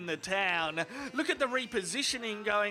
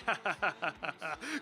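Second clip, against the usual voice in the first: mocking villain cackle; taunting staccato laugh; gloating laugh; 4 semitones lower, 3 dB quieter than usual. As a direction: laugh in the rival's face after the taunt. Snake gloats after taunting Woody.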